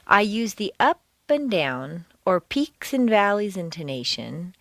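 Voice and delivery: deliberate reading with big pitch swings on stressed words; exaggerated stress. Shown as a demonstration. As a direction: stretch and swoop each stressed word, pausing between phrases. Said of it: "exaggerate the words far more than you'd normally hear".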